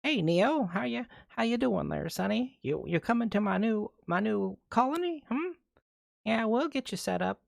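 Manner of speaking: high-pitched hammed-up old granny; badly faked old lady greeting her sonny; terrible granny voice. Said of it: "she's talking like a granny".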